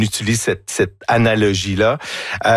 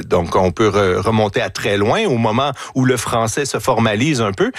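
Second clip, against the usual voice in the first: ordinary talk, nothing marked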